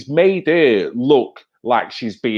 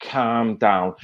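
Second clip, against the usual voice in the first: lightly imitated soothing, lower voice; mock calming; 5 semitones lower, 5 dB quieter, much slower than usual. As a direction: imitate someone patronisingly settling a person down. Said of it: "Look at him with his hand up".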